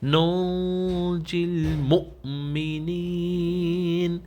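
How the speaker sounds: الْمُؤْمِنِينَ with a small catch on the hamza, slow; slight jolt. Shown as a demonstration. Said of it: "تھوڑا سا جھٹکا نما دیں گے". (حمزہ پر ہلکا جھٹکا)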